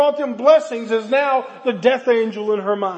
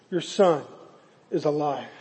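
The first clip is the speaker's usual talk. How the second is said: soft, hushed, much quieter delivery; gentle reveal; 8 semitones lower, 7 dB quieter than usual. Acted. Elijah gently telling the mother her son lives, hushed and soft. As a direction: tell her tenderly, barely above a murmur.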